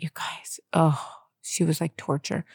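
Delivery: breathy hushed whisper to the viewers. Real, not acted. Mom whispering to her vlog viewers, hushed. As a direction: drop to a secret whisper.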